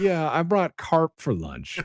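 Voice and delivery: high, loud, drawn-out coworker voice; put-on proud lunch-bringer voice; oblivious coworker. Voicing an annoying office coworker.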